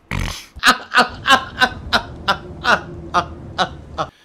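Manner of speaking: loud real laughter. Real, not acted. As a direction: burst out laughing. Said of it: "here's how I laugh".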